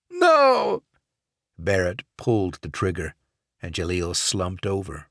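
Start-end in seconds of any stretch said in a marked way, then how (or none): 0.1-0.8 high, loud cry of terror; desperate scream. Acted. Hostage screams as Barrett fires, loud terrified no. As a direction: shout no as the trigger is pulled. Gun fired at his head.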